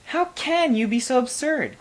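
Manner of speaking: a high sharp exclamation; the queen cries out in disbelief; crying out. A narrator's reading. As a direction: scoff at the king, voice up high. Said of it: "cried the queen".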